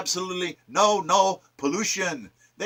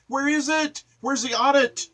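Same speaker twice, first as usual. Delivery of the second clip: much higher, demanding; indignant demand; 9 semitones higher than usual. Real, not acted. Speaker demanding Congress's audit, voice shooting up in outrage. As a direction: fire off the questions in high outrage.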